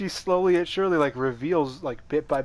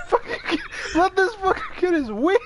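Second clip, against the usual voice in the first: laughing. Real, not acted. Laughing while ranting about wings.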